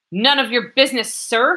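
high, loud flustered yell. Acted. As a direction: blurt it out too loudly. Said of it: "he yelled at last".

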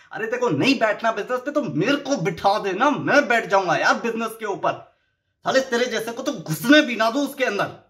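loud, high, exasperated rant; angry outburst. Acted. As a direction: scold the spoilt heir at full steam. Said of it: "यार गुस्सा चढ़ता है यार". (गुस्से में ऊँची आवाज़ में फटकार)

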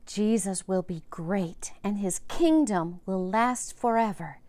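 plain reading voice

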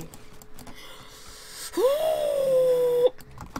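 sudden high-pitched gasp; sharp high gasp. Real, not acted. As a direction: gasp high and loud in surprise.

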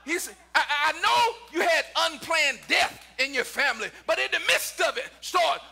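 impassioned preaching. Acted. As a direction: press each hardship toward the refrain. Preaching at full force.

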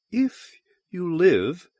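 high, very slow temptress; Valduma. A narrator's reading. Valduma bargains with Donovan, slowly taunting. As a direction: draw it out high, dangling a promise. Voices Valduma, the alien woman.

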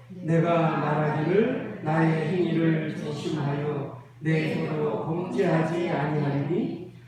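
low, measured chant of the psalm in unison; unison scripture reading. Real, not acted. Congregation reading the psalm aloud with the pastor, chanted. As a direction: read it together in a steady, sung-through cadence.

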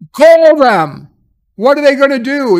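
hammed-up villain taunt; mock taunting. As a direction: dare her to call the cops. Psycho father defying her threat.

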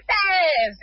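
put-on Tevez in a high, lightly American-accented voice; imitating an American fan. Fan in Los Angeles answers him, American accent. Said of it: "y me dice".

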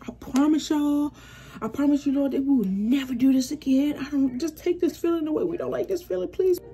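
high-pitched, pleading plea to the Lord; begging prayer. Retold or imitated. Two friends begging the Lord to take the feeling away, pleading. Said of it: "we begging the Lord".

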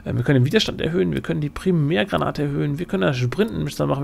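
usual speaking voice

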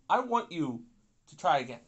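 normal talking voice

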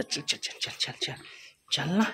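whispery, very soft prodding; whispered urging. Real, not acted. Hurrying a prank partner along, whispered urging. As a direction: hurry them along under your breath.

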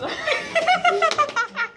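very high burst of laughter; laughing. Real, not acted. Player can't get the rude phrase out, laughing. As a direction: crack up completely.

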